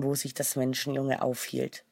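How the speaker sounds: ordinary delivery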